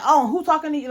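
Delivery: mock going off. Retelling the driver talking back to her mom, mock disrespectful. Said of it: "Like, going off".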